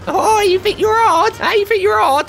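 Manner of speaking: high, loud taunting character voice; mock tough taunt. Taunting a ragdoll in the game, high mocking voice. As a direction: jeer at him like a cocky little goon.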